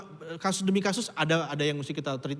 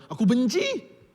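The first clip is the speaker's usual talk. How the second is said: mock confession; 9 semitones higher, 7 dB louder, much slower than usual. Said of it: "bilang aja".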